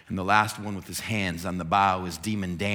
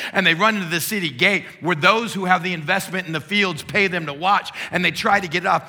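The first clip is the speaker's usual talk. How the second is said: higher, louder, faster preacher surge; preaching narration; 6 semitones higher, 6 dB louder than usual. Acted. Preacher telling the congregation of the stampede, mounting intensity. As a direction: let the story run away with excitement.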